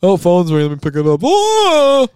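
hammy pretend phone call; mock phone answering. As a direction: play out a fake phone ringing. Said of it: "but go ahead. It's really funny".

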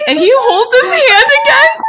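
high, loud fangirl squeal with laughter; freaking out. Real, not acted. Host freaking out over the hand-holding, squealing. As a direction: squeal it, barely holding it together. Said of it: "Why are we freaking out so hard".

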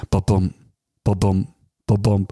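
thump it out like a heartbeat; heartbeat sound. Retold or imitated. Doctor's checkup on the mother's belly, deep heartbeat imitation. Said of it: "you hear the baby's heartbeat".

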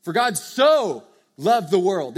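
"so" capitalized, loud and slowed; booming, drawn-out "so"; stress on SO. Shown as a demonstration. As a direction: lean hard on "so" in "For God so loved the world". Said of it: "so would be capitalized".

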